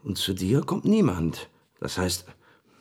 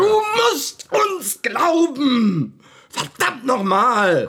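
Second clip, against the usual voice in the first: much higher, louder, slow cry of despair; desperate shout; 7 dB louder, much slower than usual. Acted. Friend pleading with the doubting colleague, desperate shout. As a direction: beg them to believe you.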